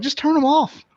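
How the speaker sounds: exasperated. Retold or imitated. Retelling scolding a customer.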